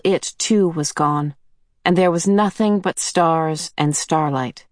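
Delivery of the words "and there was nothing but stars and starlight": ordinary delivery, nothing marked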